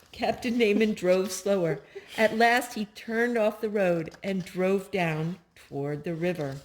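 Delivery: plain reading voice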